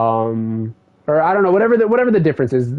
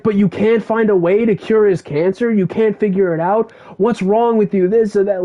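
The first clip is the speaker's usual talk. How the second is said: yelling at them; 8 semitones higher than usual. Retold or imitated. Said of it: "he's yelling at them".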